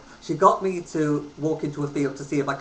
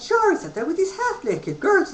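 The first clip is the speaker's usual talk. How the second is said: mimicking Dad. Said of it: "And Dad said".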